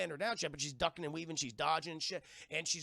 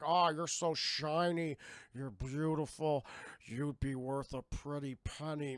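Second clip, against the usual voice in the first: mock slug monster; 3 semitones lower, slower than usual. Slug guys voiced in recap.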